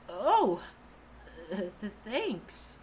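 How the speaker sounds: ordinary delivery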